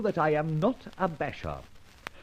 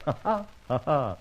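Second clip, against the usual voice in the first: amused laugh. Acted. Villain laughs off police.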